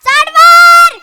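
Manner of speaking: loud, high, very slow order; commanding shout. Retold or imitated. Daughter-in-law ordering guest up, loud slow shout. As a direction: shout it drawn out. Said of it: "तीच म्हणाली".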